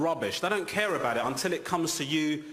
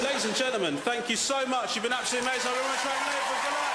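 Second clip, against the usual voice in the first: high, big showbiz announcing; high excited host voice; stage host; 11 semitones higher, faster than usual. Acted. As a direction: hype the crowd.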